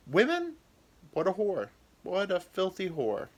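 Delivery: high scandalized scorn; judgmental gossip. Retold or imitated. Society shaming a woman, high and scornful. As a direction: sneer like a prudish busybody. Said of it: "everyone would be like".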